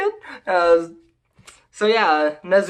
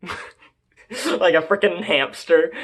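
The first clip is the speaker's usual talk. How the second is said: usual speaking voice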